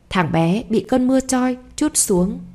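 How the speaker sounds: ordinary delivery, nothing marked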